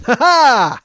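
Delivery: loud high mock maniac laugh; crazy laugh. Comic laughing in the yelling man's face, loud and high. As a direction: laugh in his face to unsettle him. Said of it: "I just laughed right in his face".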